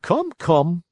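lightly voiced little man; a hint of a sly coachman. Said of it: "said the little man".

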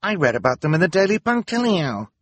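high, loud burst of recognition; astonished exclamation. A narrator's reading. Nurse who read about the children, astonished. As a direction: blurt it out, pitched right up. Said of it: "a nurse exclaimed in astonishment".

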